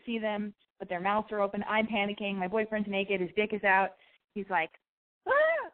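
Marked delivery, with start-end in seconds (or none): 5.3-5.7 short, very high, loud mock scream; high-pitched imitation of a shocked scream; imitated startled yelp. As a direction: yelp like someone caught naked. Boyfriend startled when walked in on.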